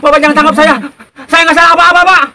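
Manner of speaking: loud, desperate pleading; frightened begging. Acted. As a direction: beg not to be taken. Eceng begs in a nightmare.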